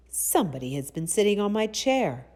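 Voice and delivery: loud, gruff storybook bear; rough, gruff Papa Bear. A narrator's reading. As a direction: boom it out as the gruff father bear. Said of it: "in his rough, gruff voice".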